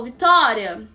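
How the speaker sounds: loud retold call of her name; imitated shout. Guy calling her outside the school, imitated shout. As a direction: mimic him hollering her name. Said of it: "Aí ele só me gritou".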